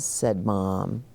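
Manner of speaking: usual speaking voice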